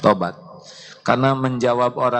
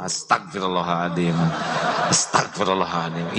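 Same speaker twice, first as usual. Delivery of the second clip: sincere istighfar; 5 semitones lower than usual. Shown as a demonstration. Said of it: "istighfarnya yang tulus".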